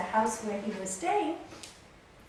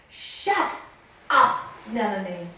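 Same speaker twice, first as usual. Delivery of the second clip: loud guru rebuke; 9 dB louder, slower than usual. Retold or imitated. Guru silences Nalini mid-argument, loud and forceful. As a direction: imitate a guru's loud command, word by word. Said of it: "Really a loud voice".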